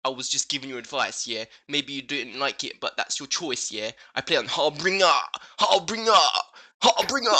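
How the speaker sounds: hammed-up smug gamer reading his reply; mock tough-guy voice. Mocking commenter's reply, "yeah?" tags.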